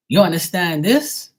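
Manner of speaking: loud, high, indignant retort; getting mad. Retold or imitated. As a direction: throw it back as an angry challenge. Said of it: "I'll get mad at 'em".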